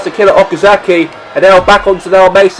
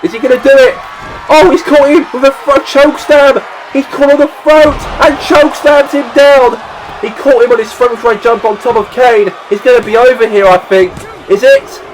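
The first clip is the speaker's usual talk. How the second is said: excited; 6 semitones higher than usual. Acted. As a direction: build to the finish and yell it. Finishing move and pin call.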